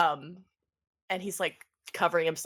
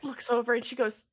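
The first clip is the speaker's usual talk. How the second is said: ordinary talk, nothing marked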